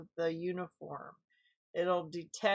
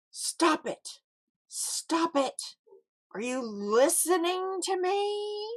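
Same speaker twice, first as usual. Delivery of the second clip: much higher, louder yelling mother; scolding mom yell; 10 semitones higher, 4 dB louder than usual. Retold or imitated. Mom yanking a Mattel employee's ear, yelling. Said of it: "and she'd go".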